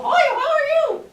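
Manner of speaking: very high, loud hi how are you; high greeting. Shown as a demonstration. Teacher showing a greeting in head voice. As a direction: chirp it way up high. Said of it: "How can you talk like that and go".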